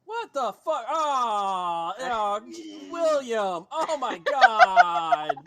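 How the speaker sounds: high, loud cries of alarm; acted horrified outburst, drawn out at the end; panicked crowd. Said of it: "And you hear people going".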